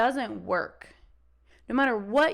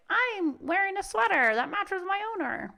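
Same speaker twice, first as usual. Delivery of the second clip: higher, prissy lapdog voice; pampered little dog; 8 semitones higher than usual. Retold or imitated. Small dog bragging about its sweater, higher and prissy. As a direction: voice a spoiled lapdog.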